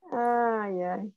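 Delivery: usual speaking voice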